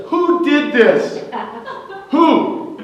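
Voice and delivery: slow, high mock-angry demand; angry shout. Coach yelling at the wrestling team in anger. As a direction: bellow it like a furious coach. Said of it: "he really gets mad".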